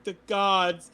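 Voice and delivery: high, bright gush of relief; overjoyed. Acted. Lost traveller overjoyed to hear of a village, gushing. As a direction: gush with delight.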